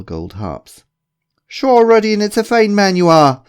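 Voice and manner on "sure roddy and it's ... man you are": booming, high-pitched mock-Irish; over-the-top Irish brogue; fake Irish accent. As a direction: play the hearty Irishman, badly. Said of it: "Why are you pretending to be Irish?".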